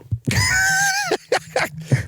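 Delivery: high, piercing burst of laughter; shrieking laugh. Real, not acted. Laughing at his friend getting burned, shrieking laugh. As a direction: let out a gleeful shriek of a laugh.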